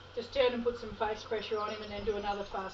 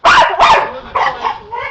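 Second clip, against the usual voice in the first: excited praise; 13 semitones higher, 19 dB louder than usual. Real, not acted. Trainer calling praise to the working dog, excited. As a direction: cheer the dog on from afar.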